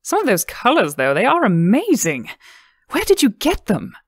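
high, eager questioning lilt; eager woman. A narrator's reading. Woman diner eagerly asking Lindsay about colors, excited. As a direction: press her eagerly for the secret.

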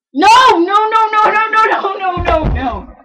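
loud repeated no; loud protest. Real, not acted. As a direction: shout no over and over. Protesting loudly.

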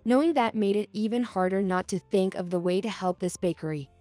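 ordinary delivery

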